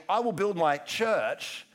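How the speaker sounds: slow line landing on a stretched "church"; stress on church. Shown as a demonstration. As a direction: make "church" the word that matters. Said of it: "the emphasis is always on".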